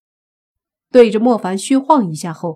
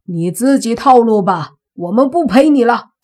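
loud, higher dismissive shout; 4 semitones higher, 7 dB louder than usual. Acted. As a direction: yell it back scornfully. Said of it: "苏三大喝一声".

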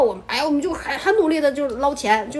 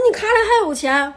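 high, loud surprised asking; baffled at why she tops up; puzzled question; 8 semitones higher, 5 dB louder than usual. Retold or imitated. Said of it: "我说". (高声惊讶地问)

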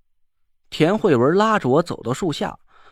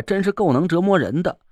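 plain reading voice

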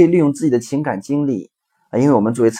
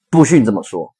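stern mother; 5 dB louder, faster than usual. Retold or imitated. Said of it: "妈妈说".

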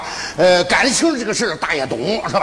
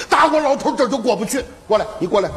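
usual speaking voice

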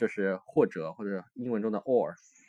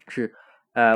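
ordinary talk

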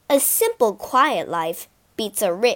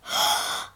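breathy gasp of wonder; short acted intake of breath; amazed gasp; 6 dB quieter than usual. A narrator's reading. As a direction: gasp in astonishment. Mouse amazed by the food.

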